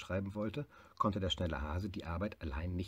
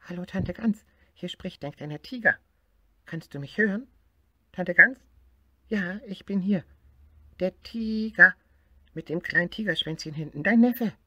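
much higher and louder calling; tiger shouting down phone; 12 semitones higher, 9 dB louder than usual. Acted. Tiger calls aunt goose on the new phone, loud and high. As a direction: shout so aunt can hear.

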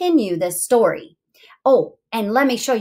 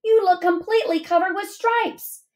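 high, dramatic storybook alarm; mildly hammed-up shock; mock scream; 9 semitones higher than usual. A narrator's reading. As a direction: exclaim it high, without really screaming. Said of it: "she screamed too".

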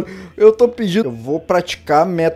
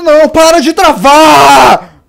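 frustrated yell; 6 semitones higher, 8 dB louder, slower than usual. Real, not acted. Game freezing.

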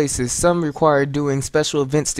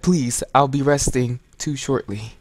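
ordinary talk, nothing marked

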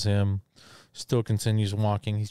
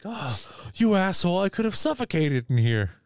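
higher-pitched indignant protest; mock outrage; 8 semitones higher than usual. Retelling outraged character freed from trunk.

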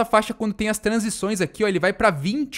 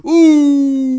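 disappointed groan; 5 semitones higher, 8 dB louder than usual. Real, not acted. Goal attempt just missed.

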